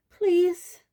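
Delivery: high, loud plea; pleading. A narrator's reading. Hermione pleading with centaurs, high urgent voice. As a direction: beg them, pitched high.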